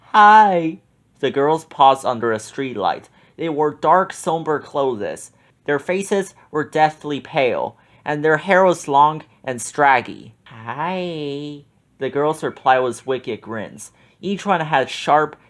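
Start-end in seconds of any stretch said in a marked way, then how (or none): 0.1-0.8 high, loud eager greeting; Shaggy flirting; flirty hi. 10.5-11.6 much softer ghostly reply; eerie hi.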